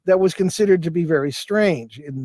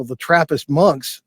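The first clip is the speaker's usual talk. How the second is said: normal talking voice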